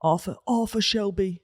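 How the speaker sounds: Brummy take on the name; lightly put-on Brummy accent. Host echoing co-host's impression, Brummy accent. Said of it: "That's Brummy".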